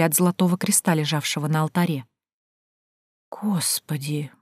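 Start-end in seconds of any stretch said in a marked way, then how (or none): 3.3-4.3 hushed, very slow whisper; drawn-out awe. A narrator's reading. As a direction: stretch it out in a reverent whisper. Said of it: "протянула Танька и перекрестилась".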